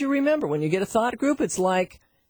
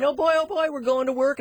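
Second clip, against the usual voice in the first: higher, eager voicing of a happy dog; excited dog voice; 6 semitones higher, faster than usual. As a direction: bounce it like a dog thrilled to go. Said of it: "He'd be jumping up saying".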